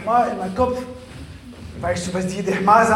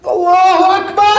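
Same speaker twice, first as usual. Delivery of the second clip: high loud cry; shouting; 10 semitones higher, 12 dB louder than usual. Acted. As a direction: yell it out at the top. Shouting joke.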